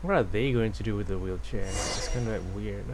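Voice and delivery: ordinary talk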